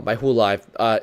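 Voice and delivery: ordinary talk, nothing marked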